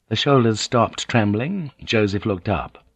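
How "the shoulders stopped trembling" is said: ordinary delivery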